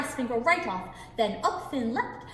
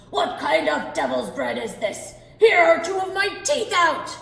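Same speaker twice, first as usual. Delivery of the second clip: pained angry giant; 5 semitones higher, 10 dB louder than usual. Acted. Giant in pain, furious.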